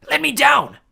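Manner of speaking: loud, high insult; furious yell. Acted. Clarice insulting the Cyclops, loud yell. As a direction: spit the insult up at him.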